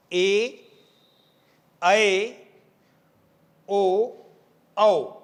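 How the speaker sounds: slow teacher's recitation of the vowels; model vowels. Shown as a demonstration. Teacher reciting the vowels for the class to copy. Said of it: "आप सब अच्छा उच्चारण करते हैं".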